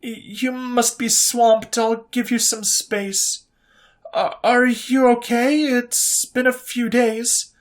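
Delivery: high, halting, pleading voice; nervous pleading messages to Coleman; needy, desperate messages. A narrator's reading. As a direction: read the texts as an insecure, clingy girl. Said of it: "how disgustingly desperate and needy the messages".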